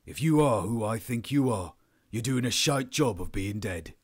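stern, forceful dressing-down; angry officer rebuking a captive; angry interrogator. Acted. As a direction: confront the prisoner with controlled anger. Powell angrily dresses down prisoner.

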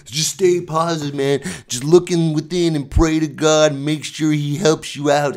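high, loud hammed-up sermon voice; mock preacher. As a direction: preach it like a revival pastor in jest. Mock preacher pep talk.

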